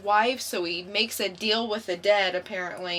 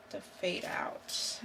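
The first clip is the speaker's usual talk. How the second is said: slow ominous whisper; 2 semitones lower, 10 dB quieter, slower than usual. Acted. Reading ominous comic title.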